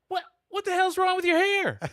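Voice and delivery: high, loud shocked question at the hair; shocked outburst. Retold or imitated. Father at dinner shocked by daughter's haircut, high and loud. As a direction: gasp it out in disbelief.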